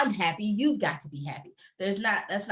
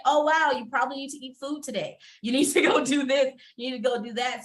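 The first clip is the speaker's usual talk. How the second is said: higher-pitched, urgent inner voice; voiced self-reminder; 5 semitones higher than usual. Reminding herself of forgotten chores, higher mock-urgent self-talk. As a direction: talk to yourself in a higher, pressing voice. Voicing own realization to herself.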